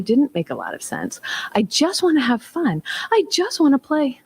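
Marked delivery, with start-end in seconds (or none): 1.5-4.2 higher-pitched whining kid imitation; upset child. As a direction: plead like a frustrated little boy. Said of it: "and he screamed".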